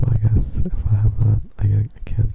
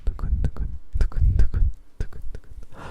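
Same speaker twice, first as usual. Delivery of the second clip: whispered trigger sounds. Acted.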